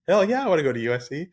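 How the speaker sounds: high, fast and eager; quick high-pitched hell yeah; eager yes. Retold or imitated. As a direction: jump at the invitation. Eager reply to campus invite.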